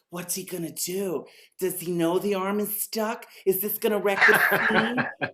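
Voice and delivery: mock unease, questions building in volume; weirded-out audience. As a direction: play the uneasy crowd, half amused. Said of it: "getting kinda weirded out".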